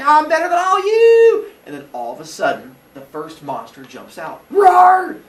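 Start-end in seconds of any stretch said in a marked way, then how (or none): 0.0-1.5 high, loud gloating shout; boastful shout. Acted. Teacher voicing Phaethon gloating from the sky, loud high shout. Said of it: "He's like". 4.5-5.2 loud, high mock monster roar. Teacher roaring as the monster leaping out, loud.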